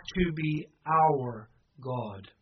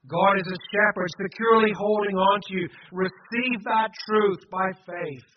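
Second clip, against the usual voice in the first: louder, raised preaching; forceful urging; 3 semitones higher, 6 dB louder than usual. Real, not acted. Preacher urges congregation to receive truth, forcefully. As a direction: press it home with conviction.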